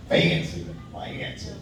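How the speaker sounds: whispered, teasing "fancy, fancy"; hushed, mocking posh murmur. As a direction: breathe it out like a snooty aside.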